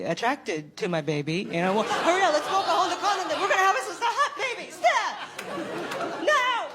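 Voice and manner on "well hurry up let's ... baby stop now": frantic mock urgency. Mocking eager rush to conceive.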